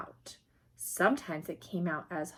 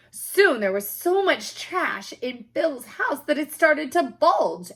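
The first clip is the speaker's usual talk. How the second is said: higher, louder building suspense; dramatic build; 7 semitones higher, 7 dB louder than usual. A narrator's reading. Reader builds drama as trash bulges, excited. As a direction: swell it up like a bulging pile.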